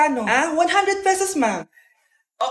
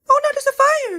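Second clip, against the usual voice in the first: TikTok NPC live; 8 semitones higher than usual. Pretend. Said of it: "yung A I na trend sa TikTok".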